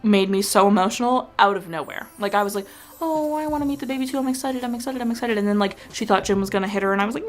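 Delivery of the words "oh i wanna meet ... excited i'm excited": high, soft, rushed excited squeal; excited anticipation. Retold or imitated. Retelling her eagerness for Lady to meet the baby, excited. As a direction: gush in a small high voice, retelling it.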